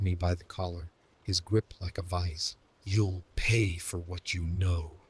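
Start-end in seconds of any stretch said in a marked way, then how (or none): 2.8-5.0 slow menacing snarl; snarled threat. A narrator's reading. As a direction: growl the threat slowly. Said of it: "he snarled".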